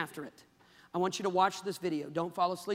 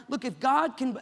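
usual speaking voice

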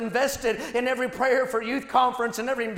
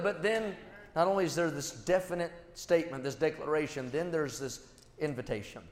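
hushed, much lower and softer sermon voice; soft intimate preaching; 6 semitones lower, 6 dB quieter than usual. Acted. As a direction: draw the congregation close with quiet conviction. Preacher retells David, hushed.

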